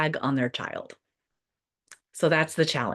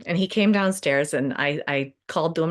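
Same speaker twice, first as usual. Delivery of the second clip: ordinary delivery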